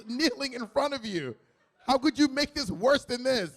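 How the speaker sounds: ordinary delivery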